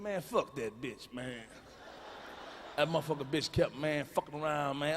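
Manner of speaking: low, soft grumble; sullen cousin. Acted. Handcuffed cousin in back seat, low sullen grumbling. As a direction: drop pitch and volume, complain under arrest.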